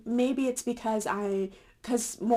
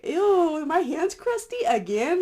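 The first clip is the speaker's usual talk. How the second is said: grossed out; 8 semitones higher, 5 dB louder, slower than usual. Retold or imitated. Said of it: "I was like".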